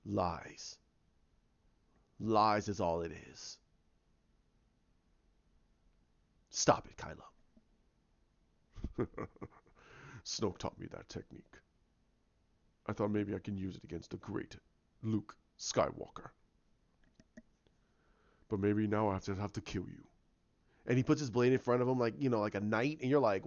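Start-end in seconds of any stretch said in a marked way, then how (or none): 0.1-7.3 low, soft acted refusal; quietly firm imitation, pitched down; rejecting the illusion. Luke telling Kylo to stop the lies, low voice. 8.8-9.4 soft mock chuckle; villain chuckle. Kylo amused at Luke, soft sinister chuckle. 10.2-20.0 low, soft villain voice; menacing Kylo. Retold or imitated. Kylo threatening to kill Luke, low and soft.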